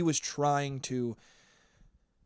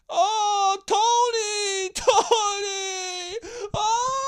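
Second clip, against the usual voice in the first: high, loud hammed-up wail of a name; mock wailing; 5 dB louder, much slower than usual. Fake friends screaming the dead cousin's name, hammed-up wail. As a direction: wail the name like a performing mourner. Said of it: "started screaming his name".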